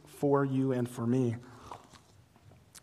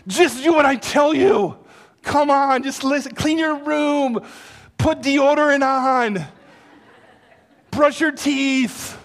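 loud, high mock parent barking orders; bossy parent commands; 9 semitones higher, 8 dB louder than usual. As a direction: play the fed-up parent laying down the law. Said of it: "that you had this power".

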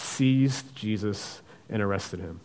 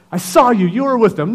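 high, loud accusing voice; accusing bystander; shrill accuser; 10 semitones higher, 9 dB louder than usual. Acted. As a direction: point the finger, voice pitched up. Preacher voices a public accuser.

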